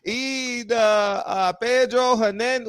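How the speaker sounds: high-pitched put-on Spanish accent. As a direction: sing out a hammed-up Spanish accent. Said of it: "How did my Spanish voice go?".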